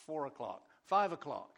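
loud, bright call to the room. Real, not acted. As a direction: call it out like an auction bid. Calling out prayer time slots.

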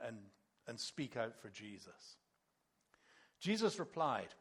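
normal talking voice

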